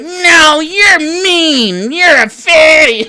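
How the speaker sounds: high, crying whine of a hurt viewer; mock whiny troll. Mocking a hurt chat troll with a fake cry. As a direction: sob it out in a babyish high voice. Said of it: "They're like".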